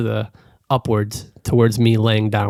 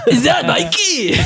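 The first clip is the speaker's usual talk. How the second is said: high, slow, gleeful cry; high excited voice; 12 semitones higher, slower than usual. Pretend. Player imagining the turtle Mikey appearing, high and delighted. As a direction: squeal it out delighted.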